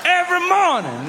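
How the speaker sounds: high, hammed-up convict threat; threatening inmate. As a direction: menace them in a crazed high prisoner voice. Prisoner threatens middle schoolers.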